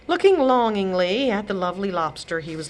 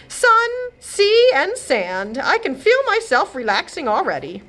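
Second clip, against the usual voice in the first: much higher, louder joy at the sea; delighted holiday voice; 10 semitones higher, 4 dB louder than usual. Acted. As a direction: bask in the sun aloud. Godmother on holiday.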